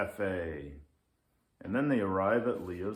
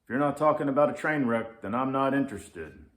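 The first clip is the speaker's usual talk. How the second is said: loud, fast curt lynx; grumpy lynx; 4 semitones higher, 7 dB louder, faster than usual. Acted. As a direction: cut him off, grumpy. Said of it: "says the Grumpy Lynx".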